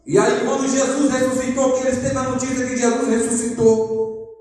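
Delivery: high-pitched, driving sermon delivery; impassioned preaching. Acted. Preaches at full force.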